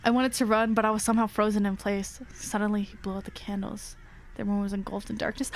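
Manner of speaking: low, hushed horror storytelling; story narration. A narrator's reading. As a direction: read it low and quiet, drawing us in. Reading horror story aloud.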